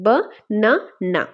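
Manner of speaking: ব-না-না slowly, in three separate beats; syllable by syllable. Shown as a demonstration. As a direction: draw out and divide ব-না-না into three syllables. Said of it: "syllable division করলে কয়টা আসে".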